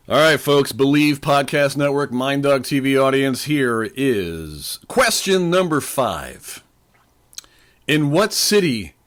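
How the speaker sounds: loud, slow game-show announcement; announcer voice. Acted. Quiz host to podcast audience, loud announcer voice.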